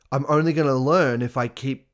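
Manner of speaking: ordinary talk, nothing marked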